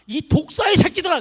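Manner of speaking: loud, high rebuke of vipers; mock rebuke. Preacher voicing Jesus rebuking the crowd, loud rebuke. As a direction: hurl it like Jesus scolding.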